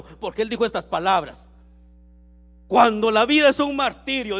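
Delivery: forceful preaching. Real, not acted. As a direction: build in volume towards the quoted words. (prédica enérgica, subiendo la voz)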